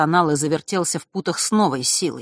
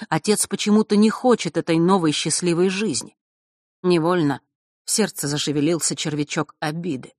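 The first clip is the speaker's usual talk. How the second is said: plain reading voice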